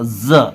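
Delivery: ज held as a buzzing "zuh"; teacher demonstrating ज with vibration; ज voiced like English z; vibrating z sound. Shown as a demonstration. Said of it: "vibration आएगा बोलते वक्त".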